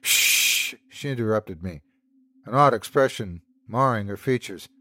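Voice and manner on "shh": hushing shh. Acted. Sandy silences her.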